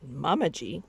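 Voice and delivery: plain reading voice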